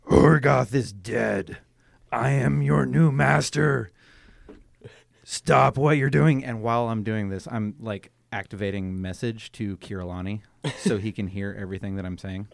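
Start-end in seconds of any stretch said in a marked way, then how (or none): 0.1-6.4 loud, slow, commanding conqueror; booming arrogant alien overlord. Acted. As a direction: proclaim yourself their new master. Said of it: "and I'm like".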